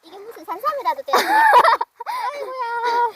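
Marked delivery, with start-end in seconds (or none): 1.2-2.3 high, loud burst of laughter; loud, high cackle; shrieking laugh. Real, not acted.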